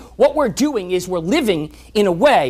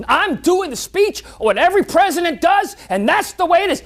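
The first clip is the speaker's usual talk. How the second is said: defiant president; 10 semitones higher, 4 dB louder than usual. Acted. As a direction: play the president who won't budge. President refuses advisors' advice.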